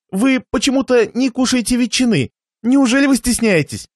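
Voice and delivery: высокий, деланно заботливый голос; уговаривай гостя, повысив тон; заботливый хозяин. Acted. Хозяин навязывает гостю ветчину. (притворно участливо)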